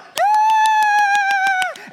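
huge high joyful shout; excited cheer. Retold or imitated. Parent cheering the toddler's step, very loud high shout. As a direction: shriek with delight. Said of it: "You, you went".

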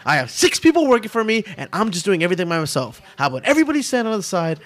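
fast, high-pitched rant; mimicked scary boss. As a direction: rattle it off like an overwhelmed boss.

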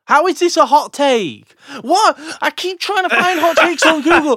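high, loud disbelieving complaint; can't believe what he's reading; baffled outrage. Real, not acted. Baffled by bad hot takes.

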